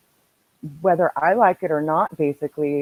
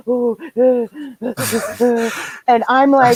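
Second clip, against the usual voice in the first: high panicked gasps and stammers; mock the caller gasping for air on speakerphone; imitating hyperventilation; 6 semitones higher, 5 dB louder than usual. Imitating a friend hyperventilating on the phone, panicked gasps. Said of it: "she's hyperventilating in my ear".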